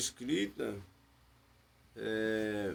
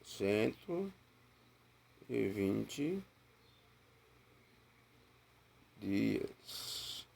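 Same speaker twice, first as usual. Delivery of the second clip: slow dictation; 4 semitones lower, much slower than usual. Shown as a demonstration. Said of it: "você vai colocar".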